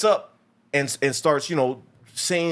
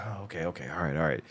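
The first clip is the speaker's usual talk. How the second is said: mild calm villain; 12 semitones lower, 9 dB quieter, faster than usual. Retold or imitated. Calm villain voiced in movie recap.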